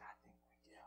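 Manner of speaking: low, near-whispered answer; hushed reply. Retold or imitated. Preacher replying to a shaken supervisor, hushed. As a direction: drop to a very soft, low murmur. His own grave reply, retold.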